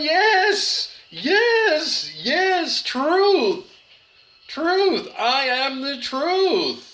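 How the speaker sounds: high, ecstatic preacher voice; preacher of Penticton. Retold or imitated. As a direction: gush 'yes, yes' like an elated preacher. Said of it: "the preacher of Penticton is going".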